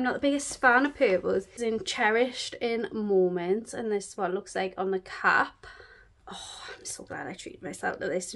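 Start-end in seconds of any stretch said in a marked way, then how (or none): 6.3-8.3 near-whispered, low and pleased; very soft, whispery aside; hushed gladness. Real, not acted. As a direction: drop to a soft whisper of relief. Quietly pleased aside about her purchase.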